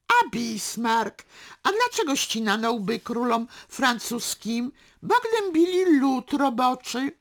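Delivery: loud, high, hectoring quiz; swelling harangue, loudest at the end. A narrator's reading. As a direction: fire off questions and crow the answers. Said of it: "Podniósł głos".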